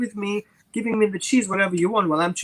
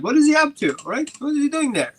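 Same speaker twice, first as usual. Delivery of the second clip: suspicious onlooker; 9 semitones higher than usual. Retold or imitated. Voicing a suspicious watcher.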